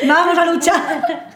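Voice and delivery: slow, mock-fighting words through real laughter; laughing. Real, not acted. Friend jokes back at the others, laughing through a slow mock battle cry. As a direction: laugh the challenge out playfully. Joking about a voice bringing war.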